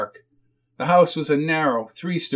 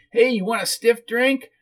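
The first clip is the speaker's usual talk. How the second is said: high whiny offer; whiny character, pitched up; whiny hostess; 8 semitones higher than usual. Acted.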